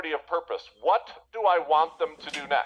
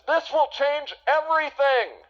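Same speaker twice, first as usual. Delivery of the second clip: hyped-up pitch; 12 semitones higher, 5 dB louder than usual. Retold or imitated. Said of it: "if your offer to them is".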